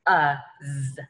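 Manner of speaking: short "Uh", then a separate buzzed z; sounded out. Shown as a demonstration. Teacher demonstrates short U and one Z, sounded out. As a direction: sound out "Uh, z" in pieces. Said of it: "I have the short U sound".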